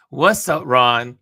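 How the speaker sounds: ordinary delivery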